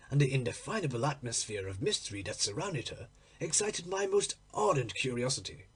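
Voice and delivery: usual speaking voice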